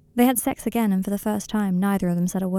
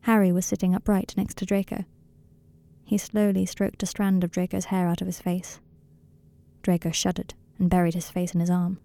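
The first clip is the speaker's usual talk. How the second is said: ordinary delivery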